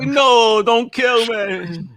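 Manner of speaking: high, slow, hammed-up begging; mock plea. Writer's character begs not to be killed, mock pleading. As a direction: whine it like a doomed character pleading.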